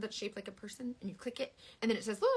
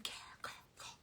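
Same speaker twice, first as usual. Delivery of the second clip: whispered stumble; 17 dB quieter than usual. Real, not acted. Fumbling the word.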